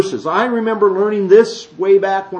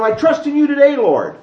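mock prayer; 8 semitones higher than usual. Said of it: "Not by walking out going".

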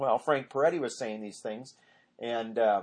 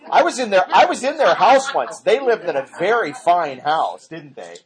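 chuckling through the words; laughing; 3 semitones higher, 6 dB louder than usual. Real, not acted. Preacher laughing as he tells the congregation about the couple's house.

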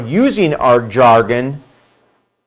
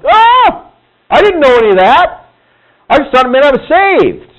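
much higher pitched, surprised and a bit louder; startled realization; 11 semitones higher, 4 dB louder than usual. Retold or imitated. People hear what being in Christ means, high startled surprise. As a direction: act out someone caught off guard.

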